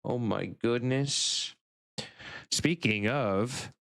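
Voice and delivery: usual speaking voice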